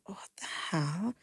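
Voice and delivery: an unvoiced, slow whisper of disbelief; whispered thought. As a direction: breathe it out under your breath, stunned. Shocked thought at crying caller.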